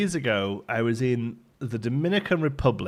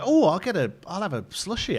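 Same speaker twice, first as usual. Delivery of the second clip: eager; 8 semitones higher, 3 dB louder than usual. Retold or imitated. Acting out own eager order.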